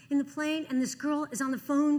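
ordinary delivery

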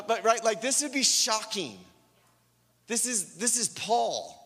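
ordinary talk

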